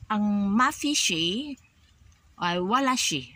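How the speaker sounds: ordinary talk, nothing marked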